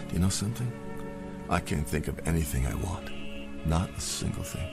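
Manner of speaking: ordinary talk, nothing marked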